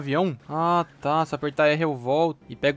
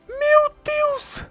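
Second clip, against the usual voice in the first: shocked shriek; 6 dB louder than usual. Real, not acted. Overwhelmed by loot screen.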